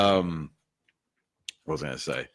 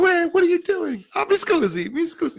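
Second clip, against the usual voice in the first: much higher, hammed-up Italian protest; startled Italian. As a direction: squeak it like a startled passenger. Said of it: "He's like".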